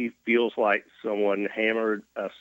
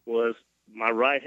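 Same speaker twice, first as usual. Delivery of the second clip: normal talking voice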